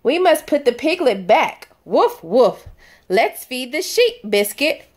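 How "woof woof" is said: playful barking woof; cheerful puppy woof for kids; dog bark. Pretend. Dog barks in picture book.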